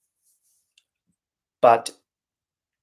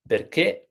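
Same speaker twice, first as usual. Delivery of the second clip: "perché" as per-KE, rising high on the end; stress on last syllable; 5 semitones higher than usual. Shown as a demonstration. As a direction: lift the stressed final ché of "perché". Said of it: "the stress to the end".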